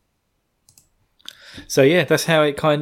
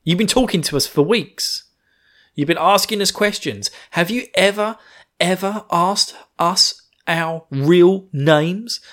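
Shin laying into her; 7 semitones higher than usual. Retold or imitated. Shin confronting her about their names, hammed-up angry higher voice. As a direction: press each question like a furious accusation. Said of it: "And he's just like".

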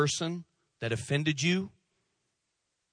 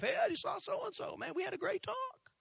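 much higher, soft, breezy chatter; breezy and oblivious, pitched way up; cheerful offender; 9 dB quieter than usual. Retold or imitated. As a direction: offender chatting happily to spouse, high and soft. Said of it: "tell their husband or wife".

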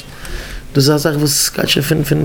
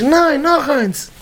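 high, drawn-out cry of insistence; slow, soaring exclamation; acted exclamation; 12 semitones higher, much slower than usual. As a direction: stretch it out high, insisting on more.

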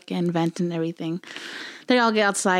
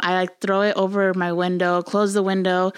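ordinary talk, nothing marked

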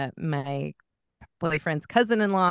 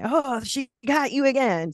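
mock crowd taunt; 8 semitones higher than usual. Crowd at bar reacting.

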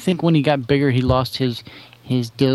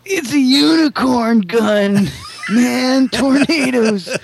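much higher and slow, shouting; excited stoner voice; 10 semitones higher, 3 dB louder, slower than usual. Acted. Stoner Doc Wizard voice.

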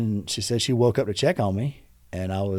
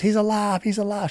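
retold screaming; 8 semitones higher than usual. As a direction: imitate a frantic scream at half volume. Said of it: "She started screaming it".

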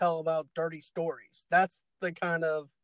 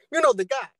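higher, louder emphatic catchphrase; excited exclaim; 7 semitones higher, 6 dB louder, faster than usual. Real, not acted. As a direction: punch it up excitedly. Repeated catchphrase riff.

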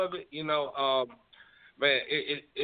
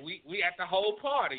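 usual speaking voice

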